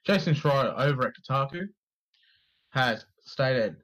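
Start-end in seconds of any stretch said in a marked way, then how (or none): none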